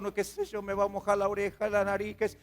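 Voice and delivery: ordinary talk, nothing marked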